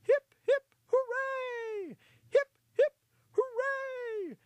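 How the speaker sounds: very high, loud mouse leading three cheers; rousing high-pitched hurrah; shouted cheer. Acted. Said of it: "One of the mice in the congregation shouted".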